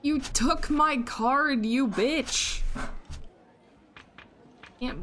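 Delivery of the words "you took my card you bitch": indignant. Real, not acted. Opponent steals card.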